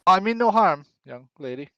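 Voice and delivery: put-on loose, slurred drunken man. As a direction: slur it like he's had a few. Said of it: "[name]'s gonna say that in, like, a drunk voice".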